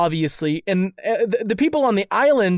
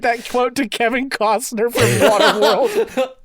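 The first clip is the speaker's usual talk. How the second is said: laughing; 3 semitones higher than usual. Real, not acted. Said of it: "laugh heard".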